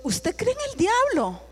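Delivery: high, slow, disbelieving question; can't believe she means it; incredulous question. Retold or imitated. To the lady, slow high disbelief.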